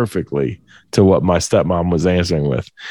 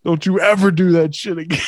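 high, quick mock shout; rapid, high-pitched telling-off; imitated scolding shout; 8 semitones higher, faster than usual. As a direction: scold like an outraged dad. Dad scolds son after prank.

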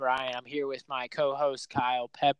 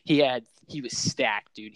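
normal talking voice

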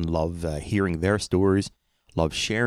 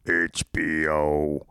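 slow, drawn-out mock command; voice command to remote; much slower than usual. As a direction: stretch it out for a voice remote. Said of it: "press the button and say".

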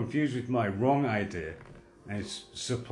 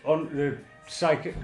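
ordinary talk

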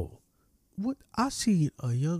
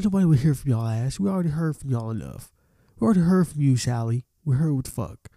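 loud, higher, scolding; angry rant; 4 semitones higher, 4 dB louder than usual. Retold or imitated. Scolding the withdrawn students, loud angry rant. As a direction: tell them off loudly.

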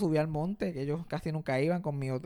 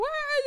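very high mock wail; imitated crying wail. Crying children arriving at grandma's house, mock wail. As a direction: bawl in imitation of crying kids. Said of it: "Llorando y qué sé yo qué más".

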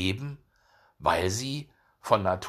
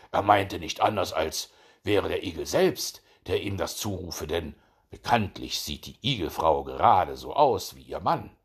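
ordinary delivery, nothing marked

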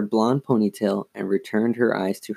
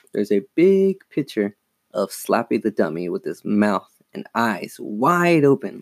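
ordinary delivery, nothing marked

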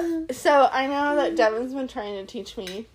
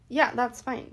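ordinary talk, nothing marked

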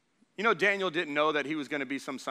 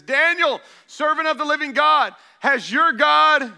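anguished call; 12 semitones higher, 13 dB louder than usual. A narrator's reading. King calls down into the lions' den, anguished and loud. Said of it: "he called to Daniel in an anguished voice".

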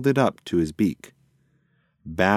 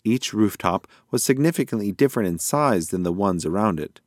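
ordinary delivery

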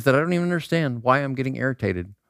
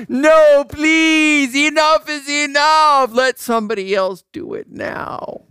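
high, loud, drawn-out mock begging; mock pleading shout; 6 dB louder, slower than usual. Pleading with a politician not to run again, loud mock pleading. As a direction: wail it out in exaggerated exasperation. Acted plea against another term.

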